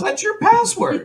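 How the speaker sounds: exaggerated incredulous retort, voice shooting up; acted retort. Imitating MacGyver answering the offended girl, high exaggerated retort. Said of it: "He goes".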